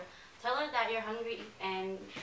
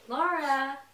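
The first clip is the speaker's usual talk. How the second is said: raised call across the house; 4 semitones higher, 6 dB louder than usual. Acted. Mother summoning Laura to supper. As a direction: call her to the table.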